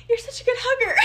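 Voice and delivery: high-pitched quote through laughter; squeaky-high, giggly retold compliment; laughing mimicry. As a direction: imitate a gushing friend while cracking up. Quotes friend while cracking up.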